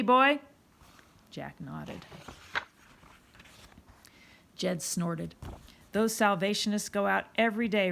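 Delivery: put-on loud, swaggering older lad showing off; a cocky gang boy sizing up the newcomer; loud Cockney street-kid bravado; tough street boy Jed.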